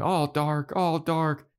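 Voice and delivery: high-pitched loony chant; crazed hospital patients. Retold or imitated. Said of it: "crazy people in the mental hospital that are going".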